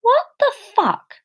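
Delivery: high, stunned swear; shocked disbelief. Acted. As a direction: blurt it out in disbelief. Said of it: "she questioned the shit".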